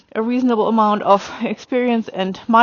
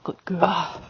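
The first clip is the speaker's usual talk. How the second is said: praising the horse; 4 semitones lower, 8 dB quieter than usual. Real, not acted. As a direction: murmur praise to the horse, low and slow. Praising her horse while riding.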